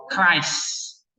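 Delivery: Christ with a hard K sound; Christ demo. Shown as a demonstration. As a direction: say "Christ" as /krajs/. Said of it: "Misalnya contohnya ini". (ucapkan Christ dengan bunyi K)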